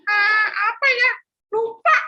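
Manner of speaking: put-on high childish voice; a little boy at the shop counter; young boy. Philip speaking to shopkeeper Pak Joko, high child voice.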